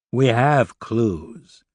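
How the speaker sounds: very slow, knowing delivery; cryptic. A narrator's reading. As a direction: stretch it out as if withholding something. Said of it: "Oliver smiled cryptically".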